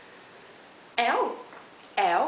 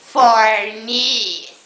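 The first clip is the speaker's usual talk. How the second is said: shouted name, much louder; grito chamando; 16 dB louder than usual. Real, not acted. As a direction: yell his name across the room. Chamando o amigo pelo nome. (gritando o nome)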